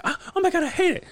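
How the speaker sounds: imitating the clerk squealing after the shock; mock squeal of fear. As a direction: squeal like the toy scared her. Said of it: "And she's like".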